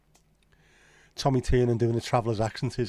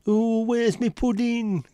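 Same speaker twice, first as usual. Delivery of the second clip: put-on squeaky old grandad wailing for his pudding; grandad next door; 12 semitones higher, 6 dB louder, much slower than usual. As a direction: whine it like a grumpy old grandad. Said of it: "he does the, uh, the grandad next door".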